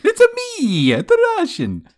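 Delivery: very high, loud mock Italian; Mario-style Italian accent. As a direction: squeak it like a cartoon plumber. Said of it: "sound like a drunk Italian".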